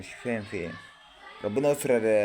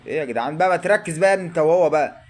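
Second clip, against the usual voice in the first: frustrated yelling; 4 semitones higher, 7 dB louder than usual. Real, not acted. Fan yelling at players on TV.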